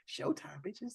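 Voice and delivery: unvoiced punchline whisper. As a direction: hiss it as a joke. Whispered joke.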